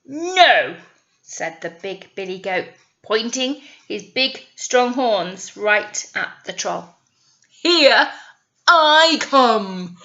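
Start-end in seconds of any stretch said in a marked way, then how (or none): 0.0-0.8 firm goat. Acted. Goat defies troll. 7.5-10.0 loud booming challenge; charging goat. Acted. Big billy goat answering the troll, loud and bold.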